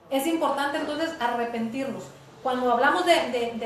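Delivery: usual speaking voice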